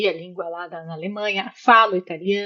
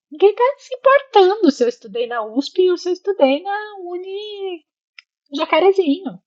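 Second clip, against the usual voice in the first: high-pitched mock indifference; raised pitch, sweeping the whole thing aside; dismissive; 10 semitones higher than usual. Immigrant on locals ignoring her Brazilian university, dismissive.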